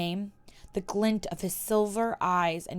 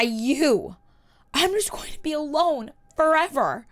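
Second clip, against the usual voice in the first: higher, louder, on the edge of crying; tearful lament; 7 semitones higher, 4 dB louder than usual. Acted. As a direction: pour out the grief. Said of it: "cried afresh".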